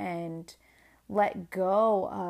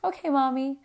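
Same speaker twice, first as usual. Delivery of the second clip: lightly voiced little kid, higher; imitating young child; 6 semitones higher, 4 dB louder than usual. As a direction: reassure Mommy in a slightly childlike voice. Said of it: "And he goes".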